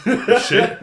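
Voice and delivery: high laughing repeat of the verdict; retelling arguing with dad about music, laughing; laughing retort. Retold or imitated. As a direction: insist on it again through laughter.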